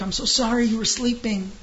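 wife's sugary apology to her sleeping husband; all apologetic. Retold or imitated. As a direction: overdo the sorry, eager to please. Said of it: "she's all apologetic".

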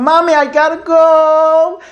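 put-on high, slow, drawn-out child's whine; desperate toddler. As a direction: whine Mommy like a desperate little kid. Toddler can't hold it anymore.

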